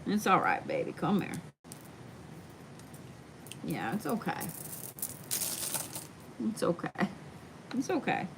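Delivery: calm a trembling dog; gentle, quiet comfort for a scared pup; soothing pet talk. Real, not acted.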